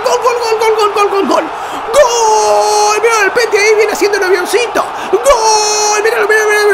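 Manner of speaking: high, shouted goal celebration. Real, not acted. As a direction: scream the goal repeatedly.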